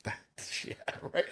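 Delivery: whispered disbelief. Retold or imitated. His doubtful reaction.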